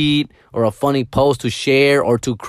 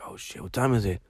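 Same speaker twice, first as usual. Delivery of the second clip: groggy whisper; 3 semitones lower, 9 dB quieter than usual. Retold or imitated. Just woken up, groggy reply.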